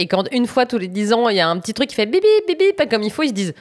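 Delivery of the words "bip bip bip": high beeps like a computer alarm; beeping imitation. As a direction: imitate a machine going beep.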